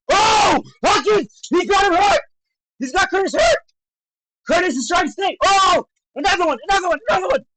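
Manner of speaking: high, loud play-by-play outburst; excited shouting. Real, not acted. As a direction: call the hurt punch as it lands, voice jumping up. Live reaction to a knockdown.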